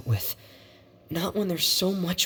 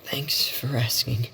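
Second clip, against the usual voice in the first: whispered. Acted. Upset brother deflecting concern.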